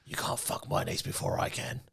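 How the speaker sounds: hushed, voiceless whisper; mock menacing whisper. Riffing as a jealous uncle, mock menacing whisper.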